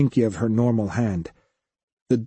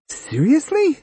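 high squeak of disbelief; disbelieving squeak. A narrator's reading. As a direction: squeak it out, can't believe it. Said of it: "Koyomi squeaked with disbelief".

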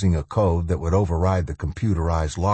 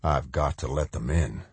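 quiet; 4 semitones lower, 6 dB quieter than usual. Acted. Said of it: "Macklin heard himself say".

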